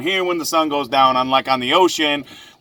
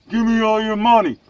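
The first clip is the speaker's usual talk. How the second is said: lightly voiced stick-up man, pitched up; mock robber; 4 semitones higher than usual. Joking about making a withdrawal, mock robber demand. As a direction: play at demanding cash in a holdup. Pretends to rob a bank.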